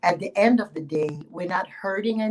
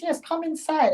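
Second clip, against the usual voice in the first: eager host; 10 semitones higher, 3 dB louder than usual. Acted. Farmer invites her inside.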